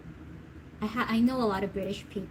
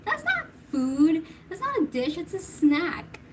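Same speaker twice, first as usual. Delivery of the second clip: indignant retort; 7 semitones higher than usual. Retold or imitated. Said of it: "I'm like".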